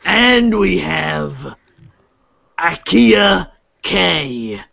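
slow, grand storyteller intro; grand intro narration. Acted. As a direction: announce the heroes like a tale begins. GM introduces the heroes.

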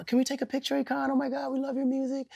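high-pitched gushing fan voice; imitated fan girls. Girls asking [name] for a picture, imitated excited fans. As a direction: put on an eager, high girlish voice. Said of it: "And they come getting all".

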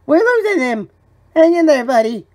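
high, loud emergency-room panic; frantic urgency. Acted. Medic to a dying buddy, frantic. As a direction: cry out as if the patient is slipping away.

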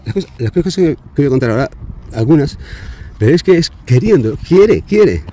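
cada vez más alto y agudo, hasta estallar; que la rabia crezca hasta el final; indignación que sube. Real, not acted. Indignación creciente. (protesta indignada, cada vez más fuerte)

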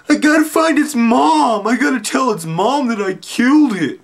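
very high, loud mock wail, slowed; crying. Narrator on the video crying to find the snail's mom. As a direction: plead through hammed-up sobs. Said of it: "crying and stuff".

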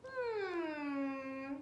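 ordinary delivery, nothing marked

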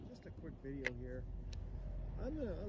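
ordinary talk, nothing marked

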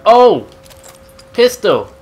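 loud, high excited call; excited shout. Real, not acted. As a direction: shout it out with joy. Excited find.

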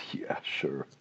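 low, hushed skeptical aside; dismissive regulator. Acted. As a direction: whisper it dismissively, as if unconvinced. Regulator's dismissive reply acted out.

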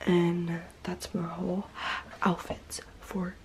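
soft trailing whisper. Real, not acted. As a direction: whisper so no one wakes. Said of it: "sorry that I'm whispering".

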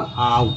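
"आउट" opening on aa. Shown as a demonstration. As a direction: model "आउट" with an aa-u glide. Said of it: "ओ का उच्चारण आ के रूप में".

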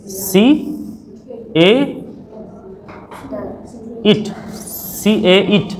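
"सी ए इट" said slowly, each letter apart; teacher showing the literal spelling reading; spelled letter by letter. Shown as a demonstration. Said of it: "तो सी ए इट तो नहीं पढ़ते".

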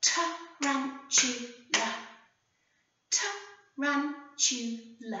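"Tarantula" said very slowly, syllable by syllable; clapped out syllables. Shown as a demonstration. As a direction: clap each beat of "Tarantula" slowly. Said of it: "Should we clap it?".

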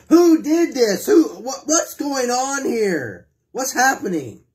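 a crowd's alarmed questions. Acted. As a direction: cry out like a shocked townsperson. Townspeople alarmed at wrecked altar.

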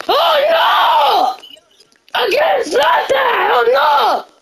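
high, wailing cries of alarm; hammed-up dismay. Real, not acted. Gamer being funny for the video, hammed-up dismay. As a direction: overplay the panic in a high-pitched wail.

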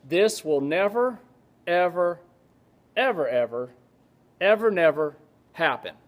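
each "ever" set apart by pauses; emphatic prohibition. Retold or imitated. Professor explaining Greek grammar to class, emphatic prohibition. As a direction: pile up "never, ever", one deliberate beat at a time. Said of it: "This is the way the Greeks will say".

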